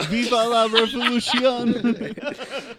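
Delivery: slow, loud, laughing chant; laughing rallying cry. Acted. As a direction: cheer it through laughter.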